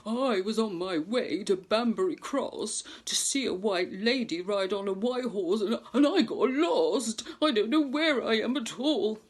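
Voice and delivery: lightly voiced fretful horse; lost horse voice. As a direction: play the lost horse, a bit bewildered. Horse character speaks.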